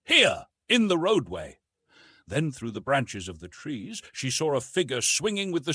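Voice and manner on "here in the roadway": loud high shout; calling back. A narrator's reading. Jane answering her rescuer, loud call. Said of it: "she called in reply".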